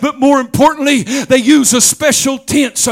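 whooping preacher's crescendo; preaching at full force. Acted. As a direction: shout the victory to the congregation.

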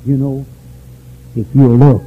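normal talking voice

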